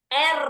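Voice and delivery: R said loud with a long rolled trill. Shown as a demonstration. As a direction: roll the R hard and hold it.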